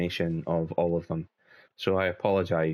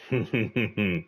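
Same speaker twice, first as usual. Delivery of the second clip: amused chuckle; 2 semitones higher than usual. Real, not acted. Player amused by the game scene, amused chuckle.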